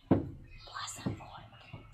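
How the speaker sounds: slow, hushed whisper; feed the name under your breath; whispered prompt. Pretend. Prompting a playmate with the friend's name, whispered.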